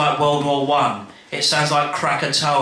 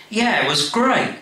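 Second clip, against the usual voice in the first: put-on higher, eager boy; high child voice; 8 semitones higher than usual. The boy tells Dad he loved the record, high and eager. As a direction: pitch up like an excited kid.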